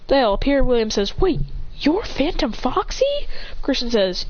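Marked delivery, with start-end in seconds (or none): 1.2-3.3 higher, drawn-out disbelief; surprised question. Acted. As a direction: ask it in rising astonishment. William voiced, astonished question.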